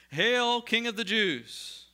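high, loud, slow mocking hail; mock salute. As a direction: jeer like soldiers saluting in scorn. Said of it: "they began to salute him".

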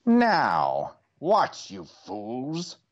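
high sneering villain cry; cackling villain ordering minions; Skeletor voice. A narrator's reading. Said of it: "cried Skeletor".

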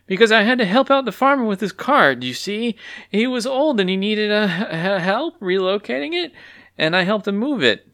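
flustered excuse. Acted. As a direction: make it up as you go. Clucky invents an excuse.

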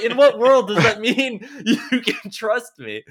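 high, loud, drawn-out disbelief with laughter; mock incredulity. Retold argument over trust.

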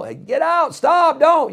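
sharp high warning shout; urgent high call to a child; yell. Shown as a demonstration. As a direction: yell to stop a child at the road. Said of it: "you should yell".

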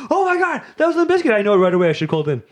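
high, excited mock fan voice; diehard fan. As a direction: squeal like a know-it-all superfan. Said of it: "diehard Limp Bizkit fans right now".

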